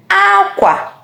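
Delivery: "Akwa" pitched high, falling at the end; clothes tone. Shown as a demonstration. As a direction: start "Akwa" high and let the end drop. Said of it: "The first one I said is aqua".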